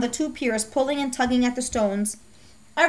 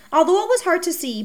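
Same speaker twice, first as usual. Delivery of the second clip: usual speaking voice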